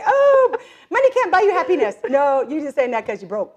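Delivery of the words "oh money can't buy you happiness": high, loud mocking singsong; mocking quote. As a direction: mimic the cliché with scorn. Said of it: "Only poor people say".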